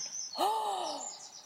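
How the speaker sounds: breathy gasp of delight; satisfied gasp. Acted. Bird after gobbling caterpillar, pleased gasp. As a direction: gasp like a tasty gulp.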